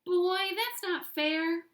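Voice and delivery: high, slow whine; whiny pigtail. A narrator's reading. Pigtail whining to Paul about fairness, high and slow. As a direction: whine like a sulky little pigtail. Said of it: "whined the pigtail".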